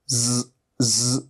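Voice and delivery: ज़, ज़ drawn out slowly as a buzzing z through closed teeth. Shown as a demonstration. Teacher slowly modelling ज़ with teeth together. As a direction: stretch each ज़ into a long z buzz. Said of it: "दोनों दांतों को थोड़ा सा मिला लीजिए".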